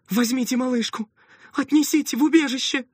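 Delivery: high, loud frantic plea; desperate shout. A narrator's reading. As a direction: shout it in panic. Frantic mother hands over baby.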